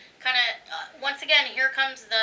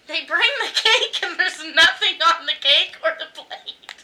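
laughing through the words, higher and louder; 6 semitones higher, 5 dB louder than usual. Real, not acted. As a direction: crack up telling the punchline. Laughing at the punchline.

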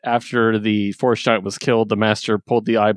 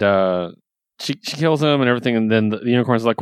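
ordinary talk, nothing marked

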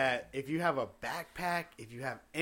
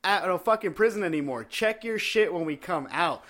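loud, slowed, exasperated complaint; mock rant; 5 semitones higher, 8 dB louder than usual. Ranting at the guard who checks bags on the way in, loud and fed up. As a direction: yell it like you're fed up at the gate.

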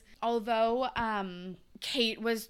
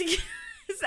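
high laugh. Real, not acted. Laughs at herself.

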